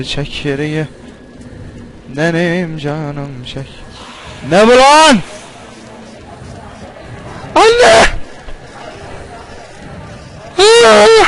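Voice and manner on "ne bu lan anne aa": high, loud frightened yells; panicked shouting. Real, not acted. Player ambushed in horror game, high panicked shouts. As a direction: scream as the scare lands.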